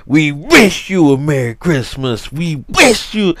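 mock-angry kids' chant, punching "wish"; angry carol. Imitating fifth graders at the school pageant, mock angry. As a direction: imitate cross children forcing out a carol. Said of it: "Why they so angry?".

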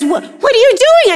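put-on high, loud startled kid; panicked little boy; imitating a scared child; alarmed child.

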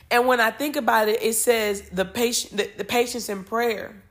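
ordinary delivery

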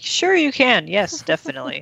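retold ready consent, lightly acted; easy agreement. Mothers agreeing to the weekend, breezy. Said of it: "they are very easily like".

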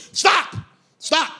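high, sharp "stop"; urgent cut-off; abrupt interruption. Acted. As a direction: halt them with exasperation. Cutting off the dude's line.

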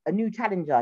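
ordinary talk

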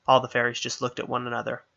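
usual speaking voice

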